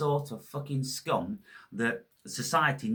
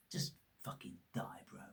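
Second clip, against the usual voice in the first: under the breath, much softer; softer whispered send-off; whispered curse; 4 semitones lower, 13 dB quieter than usual. Real, not acted. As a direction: hiss it out voiceless. Muttering insult as he leaves.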